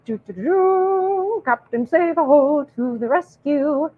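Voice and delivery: drawn-out, high mock fanfare; mock rescuing hero. Simpy beta boy rushing to the rescue, mock heroic. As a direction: trumpet the hero's arrival, slowly. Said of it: "simpy beta boy, he's gonna go".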